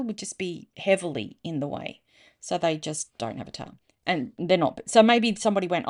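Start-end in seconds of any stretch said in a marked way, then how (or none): none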